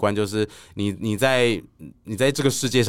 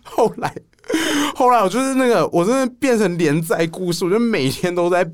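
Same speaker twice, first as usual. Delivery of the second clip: laughing retelling; 11 semitones higher, 4 dB louder than usual. Real, not acted. Laughing continues the story.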